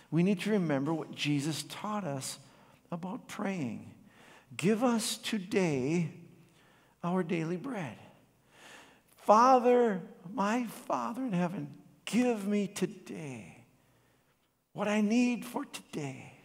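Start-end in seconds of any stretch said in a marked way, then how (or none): 4.6-16.3 slow earnest prayer, drawn-out plea. Acted. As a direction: pray it slowly and sincerely. Prays the Lord's Prayer line.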